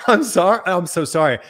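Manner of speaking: normal talking voice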